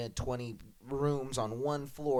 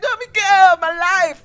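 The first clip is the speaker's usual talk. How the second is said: high anguished cry, much louder; 15 dB louder than usual. Retold or imitated. Pupil cries out to his dance teacher, high anguished cry.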